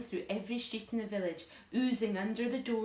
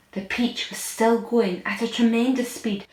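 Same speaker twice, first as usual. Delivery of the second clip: dramatic narration; 13 dB louder than usual. A narrator's reading.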